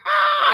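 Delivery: husband waking in panic, screaming; mock scream. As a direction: yelp in comic terror. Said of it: "So I'm going".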